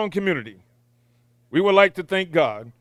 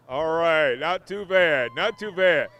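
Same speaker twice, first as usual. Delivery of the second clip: ordinary talk, nothing marked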